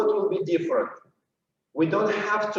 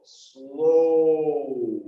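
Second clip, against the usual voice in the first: long, sustained SLOW; drawn-out SLOW. Shown as a demonstration. Dance teacher showing the first beat, drawn-out. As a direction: hold the vowel of SLOW right out. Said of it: "when we say our first slow".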